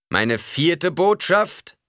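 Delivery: loud, high, drawn-out announcement; preacher proclaiming. A narrator's reading. Preacher opens his message, loud slow proclamation. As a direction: announce it slowly to a big crowd. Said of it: "sagte der Prediger".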